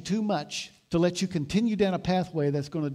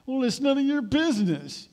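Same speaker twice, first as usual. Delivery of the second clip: higher, louder bristling comeback; defensive retort; 8 semitones higher, 4 dB louder than usual. Retold or imitated. Believer snapping back at a correcting friend, defensive. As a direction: brush them off, offended.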